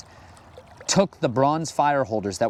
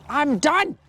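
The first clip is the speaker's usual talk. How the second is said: high-pitched exasperated outburst; fed up; 11 semitones higher than usual. Retold or imitated. God to his wayward people, fed up. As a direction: throw it out, much higher, at the end of patience.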